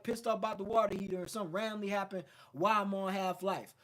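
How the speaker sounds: ordinary talk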